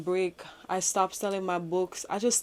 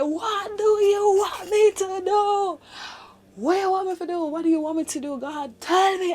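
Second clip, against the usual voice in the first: tearful high scream, louder; crying scream; 12 semitones higher, 4 dB louder than usual. Retold or imitated. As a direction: scream it out, breaking into tears. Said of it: "I was screaming, I was crying".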